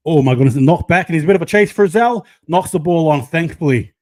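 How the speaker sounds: loud, rising alarm at a loose ball; excited play-by-play. Real, not acted. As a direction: raise voice and pitch as the chase unfolds. Live play suddenly turns dangerous.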